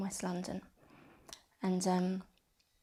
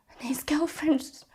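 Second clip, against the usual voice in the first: can't hold it together any more; breaking down; 6 semitones higher, 10 dB louder than usual. Real, not acted. Sister retelling her brother's death, breaking down in tears. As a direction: voice cracking into tears, higher. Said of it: "I got to stop".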